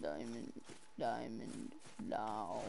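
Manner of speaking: very low, soft growl; deep voice. Real, not acted. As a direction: drop way down low.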